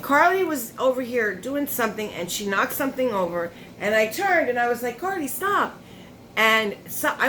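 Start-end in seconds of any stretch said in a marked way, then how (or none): 5.0-5.8 retold scolding. Scolding the cat in retold moment.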